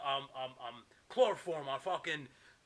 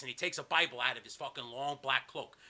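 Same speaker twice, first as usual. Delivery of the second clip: ordinary delivery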